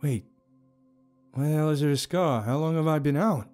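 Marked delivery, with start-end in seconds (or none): 1.3-3.5 loud, high startled demand; alarmed questioning. A narrator's reading. Startled discovery of a scar.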